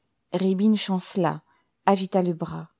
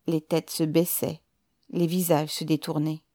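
ordinary delivery, nothing marked

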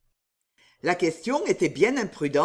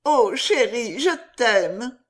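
louder, lifted declaration of love; loving outburst; 3 semitones higher, 5 dB louder, slower than usual. Acted. Lover to sweetheart once nobody listens, ardently. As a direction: swoon it out with feeling.

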